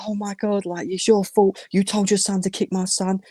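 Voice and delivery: imitating irate parents, pitched high; going mad. Angry parents blaming his dad, retold as high-pitched ranting. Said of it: "they're going mad".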